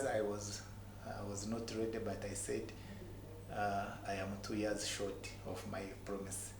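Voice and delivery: normal talking voice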